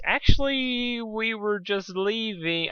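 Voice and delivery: high, slow panicky excuse; flustered Dusk backing away; nervous squeak. Acted. Nervous escape attempt.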